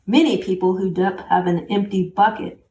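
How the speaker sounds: plain reading voice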